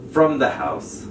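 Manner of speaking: ordinary delivery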